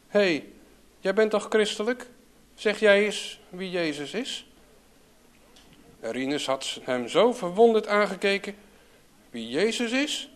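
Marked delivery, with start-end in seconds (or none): 0.1-4.5 offhand question. A narrator's reading. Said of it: "zo onverschillig mogelijk gevraagd aan Rinus". 9.3-10.3 high, amazed calling out; astonished cry. A narrator's reading. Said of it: "riep hij".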